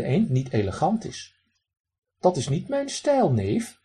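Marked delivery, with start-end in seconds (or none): 2.2-3.8 duck mimics his uncle, high and posh. Duck imitates his pompous uncle to the man, posh. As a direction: put on snooty airs. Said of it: "zegt hij dan heel bekakt".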